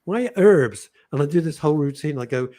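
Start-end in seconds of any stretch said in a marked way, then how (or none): 0.0-0.9 mock-indignant, slower stand-up delivery; stand-up bit. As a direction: play the puzzled Brit on stage. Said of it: "I say".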